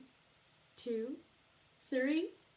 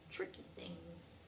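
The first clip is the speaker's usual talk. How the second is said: soft whispered ending; 7 semitones lower, 13 dB quieter than usual. Acted. Zebra consoling Bear, whispered. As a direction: drop to a whisper.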